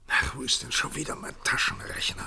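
whispered to himself while searching; soft annoyed whisper aside; muttered aside. Acted. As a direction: mutter it under your breath, hunting for the calculator. President searches for his calculator.

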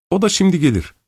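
bright high-pitched housewife welcoming a guest; midwife's voice. Acted. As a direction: pitch up high like a busy, eager landlady. Midwife explains the child.

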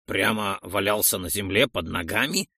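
low character voice, understated surprise; mildly surprised. A narrator's reading. Roldon questions the hero about his find, mildly surprised. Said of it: "удивился Ролдон".

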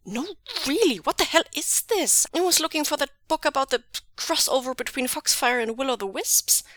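high-pitched, exasperated outburst; snapping. A narrator's reading. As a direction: snap it high with swooping stress. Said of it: "and Jingyi snaps".

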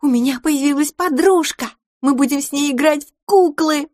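put-on high-pitched gleeful little girl; excited squeal of a child; overjoyed. A narrator's reading. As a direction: burst out with the happy news. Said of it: "закричала Пипина и запрыгала".

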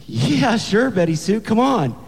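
put-on high, keen boy voice; eager boyfriend. Boyfriend hurries his date out, eager and high.

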